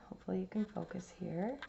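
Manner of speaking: ordinary delivery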